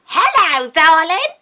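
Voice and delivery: high, loud fond hello; playful high greeting; sing-song greeting. Acted. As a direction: call it out like a cheerful bird.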